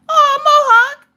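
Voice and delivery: high, loud squeal of fondness; gushing exclamation. Real, not acted. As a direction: cry out in a high-pitched gush. Cooing over Mohawk's birthday.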